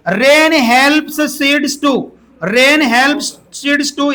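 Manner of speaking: very slow, word by word; slow dictation. Shown as a demonstration. Teacher reading the question to students, very slowly. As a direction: drag each word out for the class.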